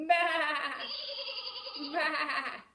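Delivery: loud mock baa; playful sheep baa; sheep bleat. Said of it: "What makes the bah sound?".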